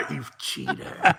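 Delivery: high, slow laughing tease; laughing taunt. Acted.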